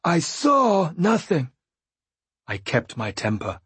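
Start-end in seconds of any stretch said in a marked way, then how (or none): 0.0-1.5 higher, slow, unhinged after a laugh; wild-eyed Fool. A narrator's reading. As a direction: voice the Fool half mad. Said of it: "He gave a wild laugh".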